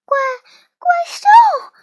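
high, loud frightened squeal; startled stammer. A narrator's reading. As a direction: stammer it, then shriek the question. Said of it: "苏丹齐声问道".

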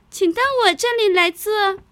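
high, small, eager little voice; a tiny ant speaking up brightly. Acted. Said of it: "小蚂蚁说".

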